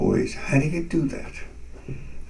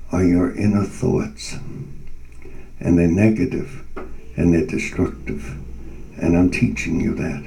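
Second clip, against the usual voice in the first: calm divine voice; 5 semitones lower than usual. Retold or imitated. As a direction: speak like a patient teacher of souls. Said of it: "I heard inside of myself".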